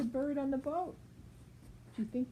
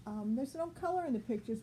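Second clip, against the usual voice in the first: ordinary delivery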